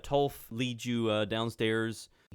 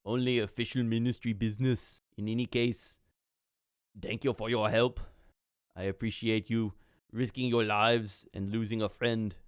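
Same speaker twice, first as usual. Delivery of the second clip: put-on accented official giving thanks; formal gratitude in a foreign accent; Atulf. Atulf thanks the party for their help, accented and formal.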